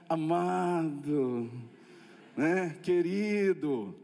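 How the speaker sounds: mock sweet pastor. Mocking today's pastors, very slow and sugary. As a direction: drag out each word like a honeyed modern pastor. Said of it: "Antigamente era sargentão".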